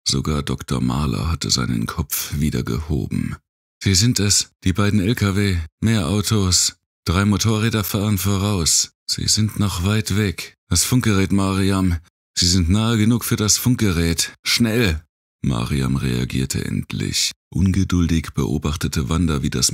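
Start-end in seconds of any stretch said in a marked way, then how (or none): 3.8-15.0 put-on urgent girlish voice, raised higher; a frightened girl hurrying to report; young girl voice. A narrator's reading.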